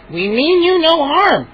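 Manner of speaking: slow, high gnome voice. Acted. Gnome repeating the reassurance, slow and high. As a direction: repeat it patiently. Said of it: "Chip repeated".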